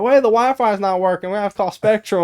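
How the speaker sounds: high, loud complaint; comic high-pitched fretting; flustered parent. Retold or imitated. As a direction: imitate a household member upset about the internet. Acts household member upset about internet.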